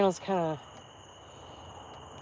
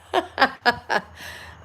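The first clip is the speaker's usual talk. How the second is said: short bright laugh; 6 semitones higher, 4 dB louder than usual. Real, not acted. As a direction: laugh at your own joke. Laughing at her own joke.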